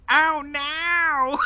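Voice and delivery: high, loud mock New Jersey "Ow, now!"; mock Jersey accent. Imitating Julie's thick Jersey accent, high and loud. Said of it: "that's a thick Jersey accent".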